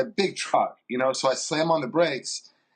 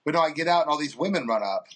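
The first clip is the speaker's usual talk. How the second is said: ordinary delivery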